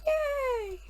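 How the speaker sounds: high, delighted reveal cry; excited squeal. Real, not acted.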